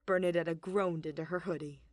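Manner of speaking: plain reading voice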